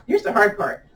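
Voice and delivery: ordinary delivery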